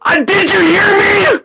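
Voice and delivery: high-pitched furious yell; angry yell. Real, not acted. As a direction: yell it, demanding an answer. Angry confrontation between friends.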